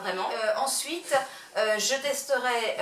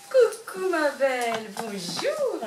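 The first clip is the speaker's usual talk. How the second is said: much higher, sing-song voice for the puppy; talking to puppy; 5 semitones higher than usual. Acted. Owner greets her puppy for the camera, high cooing pet talk. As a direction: coo a hello to the little dog.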